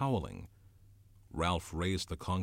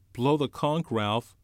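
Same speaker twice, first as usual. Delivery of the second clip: high, loud urgent plea; urgent boy; 6 semitones higher, 7 dB louder than usual. Acted. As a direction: push him to act now. Piggy urging Ralph.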